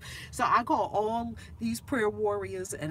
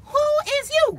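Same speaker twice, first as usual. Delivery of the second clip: stunned who; 11 dB louder than usual. Retold or imitated. Stunned mom on phone.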